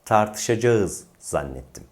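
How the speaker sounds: "Tartışacağız" as tar-tı-şa-ca-ız; wrong as-spelled pronunciation. Shown as a demonstration. Teacher repeats the mistake, "Tartışacağız" as spelled. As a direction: sound a and ı separately in "Tartışacağız", slowly. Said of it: "Tekrar yanlış".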